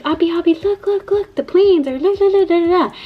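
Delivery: high, excited toddler impression; loud excited child. Mom imitating her son calling his sister, loudly excited. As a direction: mimic a kid calling out over and over. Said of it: "He was being loud".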